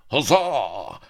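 high, loud roaring cheer; rowdy huzzah from the crew; pirate roar. A narrator's reading. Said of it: "while the pirates roared".

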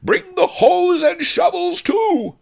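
high, loud, stretched howl. A narrator's reading. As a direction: howl the order at the top of your voice. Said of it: "howled Miser Shen".

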